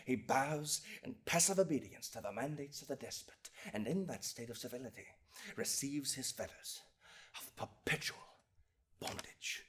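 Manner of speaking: very soft, fading almost to nothing; grave, dwindling voice; sombre oratory. Acted. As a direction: let it sink away into hushed despair. Describes man sunk into slavery.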